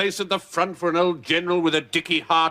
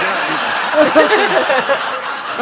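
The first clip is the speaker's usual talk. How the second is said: loud hearty guffaw; 7 semitones higher, 6 dB louder than usual. Acted. General laughs at his own quip.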